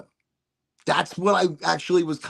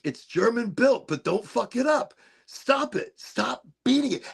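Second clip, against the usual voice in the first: high-pitched indignant maker; mock protest; 8 semitones higher than usual. Venti makers telling the streamer to stop, in high mock protest. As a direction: plead in a higher mock-alarmed voice. Voices the device makers objecting.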